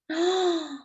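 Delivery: high surprised gasp; a quick sharp breath in wonder. Acted. Reader gasps at all the children, surprised.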